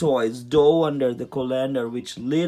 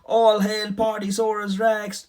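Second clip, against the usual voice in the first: higher, louder triumphant cheer; 7 semitones higher, 3 dB louder than usual. A narrator's reading. Chuck cheering Rex as party king. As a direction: hail him like a hero. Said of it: "Chuck cheered".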